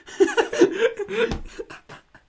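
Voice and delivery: high, loud burst of laughter. Real, not acted. Joking with a friend about the kid, high loud laughter. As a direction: crack up loudly at your own joke. Cracking up at own joke.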